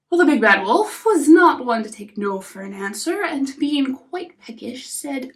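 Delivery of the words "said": plain reading voice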